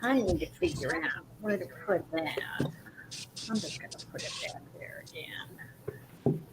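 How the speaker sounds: whispered under her breath; whispering. Real, not acted. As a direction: whisper it quietly to yourself. Muttering to herself while crafting.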